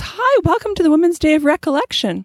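high, bright hostess welcome; cheerful sister's welcome. Retold or imitated. Sister welcoming the muffin-grabbing driver, high and bright. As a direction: welcome him like a sweet hostess. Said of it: "she goes".

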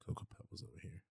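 whispered teasing aside; hushed jab at a friend's cereal pick; whispered tease. Real, not acted. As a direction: breathe it as a sly whisper. Teasing aside about cereal choice.

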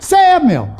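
high, loud calling of the name; God calling Samuel, high and loud. Retold or imitated. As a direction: call out across the dark. God calls a third time.

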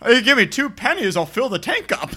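high, loud, laughing bargain voice; high loud laughing mock deal; hammed-up haggler. Spoof rental clerk.

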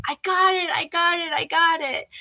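gasping daughter telling her mom she got it; ecstatic. Retold or imitated. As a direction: burst out with the good news. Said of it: "And I'm like".

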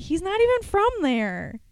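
playfully scandalized protest; mock indignation. Coworkers protesting a crossword clue about [name], mock indignant.

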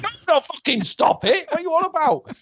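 very high, exasperated protest; panicked. Retold or imitated. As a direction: squeal it in mock alarm. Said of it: "a fucking moment of panic".

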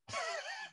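quick high laugh breaking into the quote; short laugh. Real, not acted. Quoting the film director's comment, breaking into a quick laugh. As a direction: laugh through the director's words.

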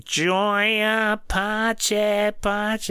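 put-on slow, high nasal singing in a Queens accent. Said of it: "you sounded like you were from Queens".

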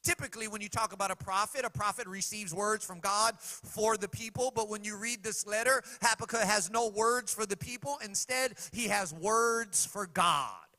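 loud, high preaching cadence; fervent sermon delivery. Acted. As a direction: preach it out to the congregation. Preaching at full force.

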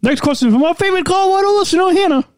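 high, bright announcer sing-song; radio voice. Pretend. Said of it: "my radio voice?".

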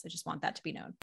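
usual speaking voice

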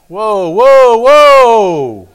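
loud, drawn-out halting cries; big booming whoas. Acted. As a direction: call a runaway thought to a stop. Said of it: "say it out loud".

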